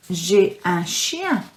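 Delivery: "J'ai un chien" spelled out slowly for pupils; slow model word. Shown as a demonstration. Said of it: "Repeat after me".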